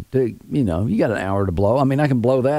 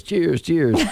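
high chirped repeat; imitated bird call; 5 semitones higher than usual. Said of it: "and they'll go".

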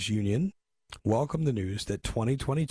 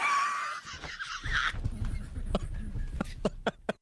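big laugh; 5 dB quieter than usual. Real, not acted. Host cracks up at wrong answer.

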